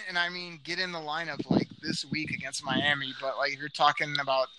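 ordinary delivery

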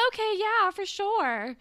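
put-on high, loud, breezy agreeing girl; a student brushing off her teacher's request to talk; chirpy teen. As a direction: toss it off cheerfully high. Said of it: "But Darcy is like".

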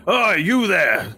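high, slow call to halt; guard calling out. Acted. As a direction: call the rickshaw to stop like a stern guard. Guard calls out to rickshaw.

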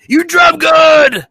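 high, loud, drawn-out holler; mock yell. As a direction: imitate a movie star yelling, words stretched. Stallone's reply echoing the yell.

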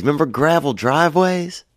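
normal talking voice

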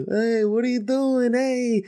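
high, slow, hammed-up Seinfeld impression; mocking impression; Jimmy Fallon doing Jerry Seinfeld. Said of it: "that's the worst Jerry Seinfeld impression I've ever heard".

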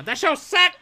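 high, loud mock shout; mock yell. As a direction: shout it like her rant. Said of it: "She's over in the corner".

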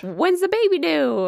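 high, drawn-out cooing question; imitated store worker. Worker to pregnant mom in the store, high customer-service voice. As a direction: imitate a clerk asking in a high, slow voice. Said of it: "was like".

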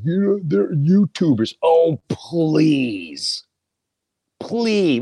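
high, scornful “please”; dismissive. Retold or imitated. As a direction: brush it off with contempt. Daughter then dismissive reply.

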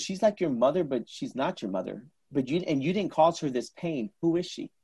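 soft, probing psychic voice; hushed fortune-telling. Retold or imitated. Card reader asking client about the woman, hushed. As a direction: probe softly, as a retold card reader.

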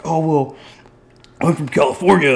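mock them, a touch up in pitch; mocking Californian. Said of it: "people are like".

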